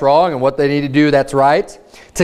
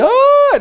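mock kid wail; 3 dB louder than usual. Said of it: "they get all crazy".

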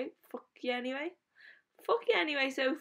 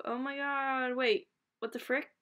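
usual speaking voice

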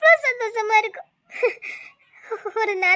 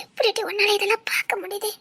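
crying whisper; 3 dB quieter than usual. Acted. Tearful friends at wedding.

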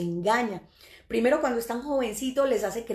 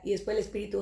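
normal talking voice

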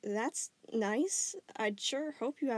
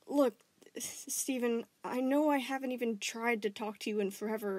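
higher, halting, rising to loud; anxious apology; 3 semitones higher, 3 dB louder than usual. Acted. Connie anxiously apologizes to Stephen, ending loud. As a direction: stumble then burst out the question.

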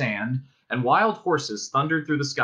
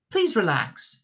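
higher, pleasant stewardess voice; 6 semitones higher than usual. A narrator's reading. Ship's voice reassuring panicked passengers, pleasant. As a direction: soothe like an airline stewardess. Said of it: "said the voice pleasantly".

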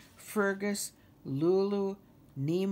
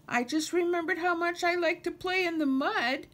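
high, louder distressed outburst; crying out; 5 dB louder than usual. A narrator's reading. Nemo realises he will lose the mud, crying out. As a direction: cry it out high, all in a rush of feeling. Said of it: "Nemo cried".

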